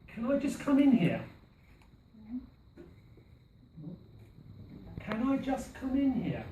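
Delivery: teacher models the phrase, leaning on IN; model phrase, stressed. Shown as a demonstration. Teacher modelling the phrase for students, stress on IN. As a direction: stress on IN, falling at the end. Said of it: "these phrases for you to give you an idea of the pronunciation".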